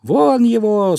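high, loud crowd cry; everyone shouting. A narrator's reading. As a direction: shout to chase someone out. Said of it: "снова закричали все".

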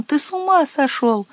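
high, horrified exclamation; high-pitched panic without shouting; alarmed cry. A narrator's reading. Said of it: "закричал он".

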